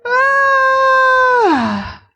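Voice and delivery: long high hammed-up wailing cry. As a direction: let out a long mock wail, very high. Long high cry mocking boys.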